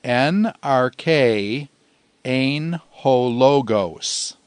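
slow, measured Greek for repeating along; say-along recitation. Shown as a demonstration. Teacher leading students through the verse in Greek, slowly recited. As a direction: recite word by word, leaving small gaps. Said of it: "Say it with me in Greek".

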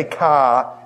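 "car" with the R dropped, Boston style; Boston "car", open vowel and no R; Boston accent. Shown as a demonstration. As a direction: say "car" as "cah". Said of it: "Do you know that I'm from Boston".

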